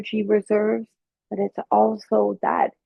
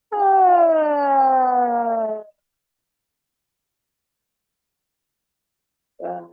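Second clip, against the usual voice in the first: a big high, open-mouthed yawning "ah"; demonstrated yawn; 5 semitones higher than usual. Shown as a demonstration. Teacher leading the class into a yawn, big open yawn. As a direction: yawn it out wide and long. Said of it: "everybody do a yawn".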